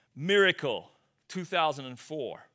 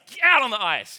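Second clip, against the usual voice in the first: loud mock coach bark; angry coach yell; 6 semitones higher, 9 dB louder than usual. Mad coach sends players out, loud mock order. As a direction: order them out on the ice, fed up. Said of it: "coach is mad".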